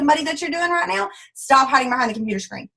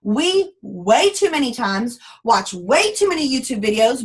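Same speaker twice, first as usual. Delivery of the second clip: ordinary talk, nothing marked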